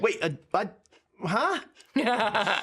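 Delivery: halting, bewildered double take; confused sorcerer. Retold or imitated. Sorcerer greets the returning heroes, bewildered and stammering. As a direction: stammer it out slowly, completely baffled. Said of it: "Confusing the sorcerer".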